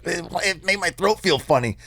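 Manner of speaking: put-on high, slow little kid voice; small boy whining; child voice.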